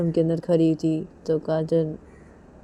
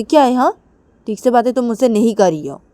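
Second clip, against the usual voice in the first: higher, louder accusation; accusing Kajal; 6 semitones higher, 10 dB louder, faster than usual. Acted. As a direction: press the point.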